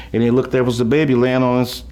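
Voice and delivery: ordinary talk, nothing marked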